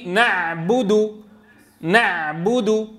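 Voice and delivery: نعبد drawn out very slowly, the final دال stopped; slow recitation demo. Shown as a demonstration. Teacher reciting a word slowly for the class. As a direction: recite نعبد slowly, leaning on the last letter. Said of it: "كلمة آخرها ضمة".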